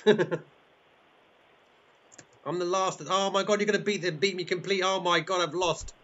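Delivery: high-pitched flustered loser; playful dismay. Pretend. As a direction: lament defeat in a high voice. Host losing the virtual race.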